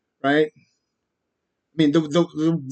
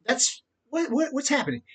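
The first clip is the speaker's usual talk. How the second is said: high bewildered hush; 9 semitones higher, 6 dB quieter than usual. Retold or imitated. Imagined victim's confusion.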